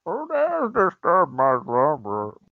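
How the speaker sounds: slow, loud villain growl; menacing masked villain voice; Bane impression. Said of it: "I launched into a Bane impression".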